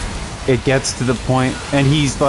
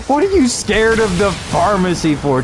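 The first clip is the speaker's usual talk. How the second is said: much higher, squeaky mock disbelief; mocking incredulous boyfriend; 9 semitones higher than usual. Boyfriend mocking her pharmacy fear, in a squeaky high voice. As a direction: ham up his baffled question. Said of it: "And he's like".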